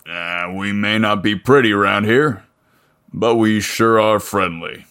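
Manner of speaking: a lightly voiced burly mechanic, welcoming; friendly big mechanic. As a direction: greet him like a friendly small-town giant. Voices Leo.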